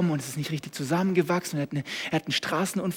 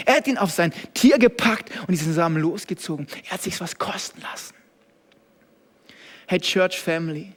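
ordinary talk

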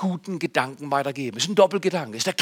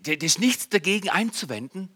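normal talking voice

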